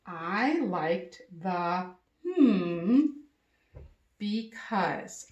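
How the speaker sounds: slow, drawn-out frame with a gap; teacher modelling a sentence frame slowly. Shown as a demonstration. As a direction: leave the blank hanging. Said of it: "Here's what the sentence frame looks like".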